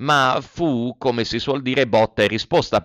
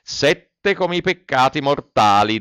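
usual speaking voice

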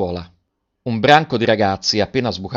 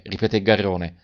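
ordinary delivery, nothing marked

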